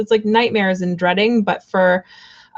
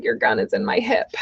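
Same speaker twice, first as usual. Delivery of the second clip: deep mock movie voice; 9 semitones lower, 3 dB quieter than usual. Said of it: "It's like".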